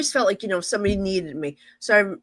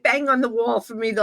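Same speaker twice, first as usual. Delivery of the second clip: plain reading voice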